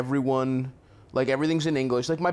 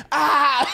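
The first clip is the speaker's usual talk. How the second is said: high squealing mock scream through a laugh; a hammed-up little-girl shriek; 12 semitones higher, 6 dB louder than usual. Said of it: "She starts screaming".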